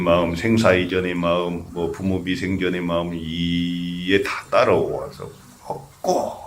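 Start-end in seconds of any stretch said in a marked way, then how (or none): 3.1-6.4 "이에" held very long, then "먹고"; drawn-out hwadu. Shown as a demonstration. Monk demonstrating how to hold the hwadu, drawn-out "이". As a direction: stretch "이" slowly so everything follows into "먹고". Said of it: "이렇게 해야 되는".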